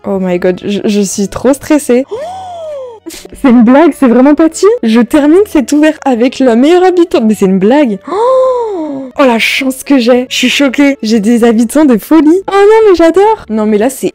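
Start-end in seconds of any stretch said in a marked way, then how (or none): 2.1-7.2 much louder, higher disbelieving joy; real elation at the last card; overjoyed. Real, not acted. Finally gets Patty. 8.1-13.5 very high, louder gushing joy; overwhelmed with delight; ecstatic squeal. Real, not acted. Still ecstatic over Patty.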